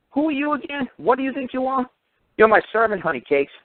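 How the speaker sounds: mock them from way up high. Mocking the people who claim his property, in a high sneer.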